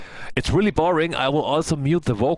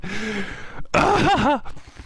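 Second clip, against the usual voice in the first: falling giggle from a high start; high-pitched laughing fit; real laughter; 8 semitones higher than usual. Real, not acted. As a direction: laugh helplessly at yourself. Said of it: "I'm almost crying".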